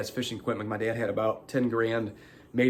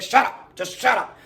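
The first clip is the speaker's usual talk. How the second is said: mock annoyed; 10 semitones higher, 4 dB louder than usual. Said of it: "He's like".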